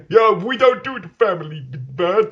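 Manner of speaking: hammed-up Italian American mobster. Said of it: "That's what Mafia people talk like now".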